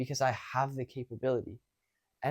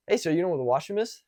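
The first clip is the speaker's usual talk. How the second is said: quick, more confident question; a bit louder; 3 semitones higher, 4 dB louder, faster than usual. Shown as a demonstration. Later practice asking a stranger for the washroom, louder. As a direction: speak up and ask it briskly. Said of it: "I said it a bit louder".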